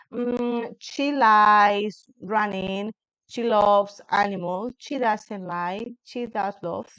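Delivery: like a student recording a slow presentation; slow, careful English read-out. Shown as a demonstration. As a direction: read each English sentence slowly and deliberately. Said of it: "entonces empiezo a grabar".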